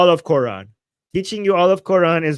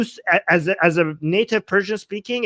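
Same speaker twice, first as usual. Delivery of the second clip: ordinary delivery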